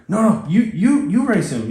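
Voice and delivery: high-pitched imitation of a flustered partner; mock panic, voice pushed up high; panicking. Partner begs him to race instead, mock panicking.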